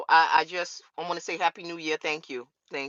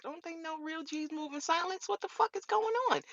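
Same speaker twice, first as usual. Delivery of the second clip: higher, incredulous mock thought; exasperated inner voice; 9 semitones higher, 7 dB quieter than usual. Answering fans who ask why she's quiet, in high mock disbelief. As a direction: pitch it up in disbelief. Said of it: "And I was just like".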